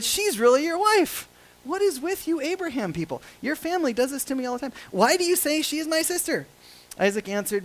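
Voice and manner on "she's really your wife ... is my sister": high-pitched acted indignation; exasperated scolding. Abimelech confronts Isaac over his lie, exasperated scolding. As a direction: play a king put out at being fooled.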